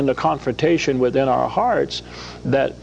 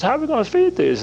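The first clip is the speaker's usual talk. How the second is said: higher, quicker voice for Jesus asking; voicing Jesus; 11 semitones higher, faster than usual. Guest voicing Jesus asking the disciples, higher. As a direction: ask it brightly as Jesus testing.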